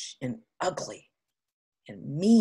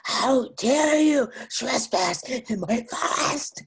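slow, higher monster voice; huge monster; 5 semitones higher, 4 dB louder, slower than usual. Acted. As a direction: menace him with long-drawn monster words.

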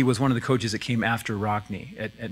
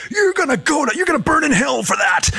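imitating an irate coach yelling, high and loud; hammed-up high-pitched coach's tirade; furious shout; 7 dB louder than usual. Coach to his center after a bad snap, shouting. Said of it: "he looks in his eyes, and he shouts".

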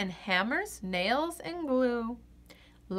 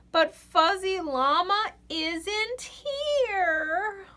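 higher, slow, near tears; tearful; 8 semitones higher, slower than usual. A narrator's reading. Reader showing Llama's sadness, tearful. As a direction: let the voice crack with sadness.

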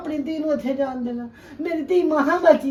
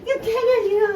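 tearful; 5 semitones higher than usual. Acted. Tearful mother reproaching lost daughter.

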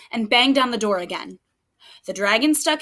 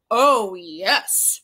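lower, smug dragon yes; proud dragon; 5 semitones lower than usual. Acted. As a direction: boast like a vain dragon.

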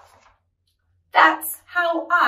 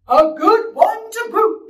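loud, high, slow chorus-like echo; crew agreeing; 7 semitones higher, 8 dB louder, slower than usual. Acted. Crew echoes the captain's praise, loud agreement. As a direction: echo it heartily as a pirate crew. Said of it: "the others agreed".

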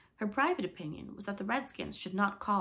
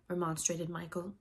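plain reading voice

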